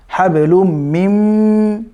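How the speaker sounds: হাবেলুম মিম run together with a long nasal m; joined mim. Shown as a demonstration. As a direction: hum through the join in হাবেলুম মিম, slowly. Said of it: "এই মিমের সাথে connected হবে".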